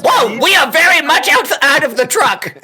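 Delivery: high, loud panicky yelling; panicked shout. Acted. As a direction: blurt it out in a panic. Character panics under fire.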